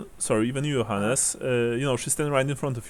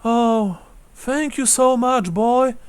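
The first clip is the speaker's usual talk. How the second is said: put-on high-pitched old widow, overcome with gratitude; frail old lady pouring out thanks; grateful old woman; 9 semitones higher than usual. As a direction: lift the voice high, lamenting and thankful. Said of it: "she says".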